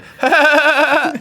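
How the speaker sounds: high, loud cartoon laugh; SpongeBob laugh. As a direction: cackle like SpongeBob. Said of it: "I'll do SpongeBob".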